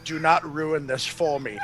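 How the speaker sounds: low, restrained warning; Sergio. Acted. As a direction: plead firmly under your breath. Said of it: "you see Sergio's face pop out".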